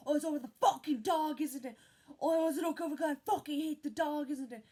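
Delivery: loud, high mocking yell at her crying son; angry mum yelling. Mum yelling at him for crying over the dog, mocking shout. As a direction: spit the words out like a furious mother. Abusive mum voiced in story.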